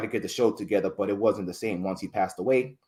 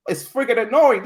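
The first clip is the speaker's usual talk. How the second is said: high, loud mock dismay; appalled by own voice; 9 semitones higher, 8 dB louder than usual. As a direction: act out someone recoiling at hearing themselves. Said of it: "a lot of people don't like their voices".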